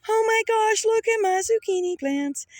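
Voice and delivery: high, loud delighted squeal; thrilled at the zucchini; excited. Real, not acted. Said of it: "look how happy I am about my zucchini plants".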